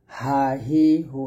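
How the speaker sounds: হা হি হু drawn out slowly, one by one; slow recitation drill of হা হি হু; slow drilled syllables. Shown as a demonstration. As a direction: spell out হা হি হু slowly for students. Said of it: "এরকমভাবে".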